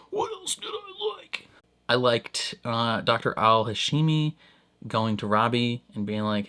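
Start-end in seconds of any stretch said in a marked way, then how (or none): 0.1-1.5 playful squeak. Pretend. Playful repeat in odd voices.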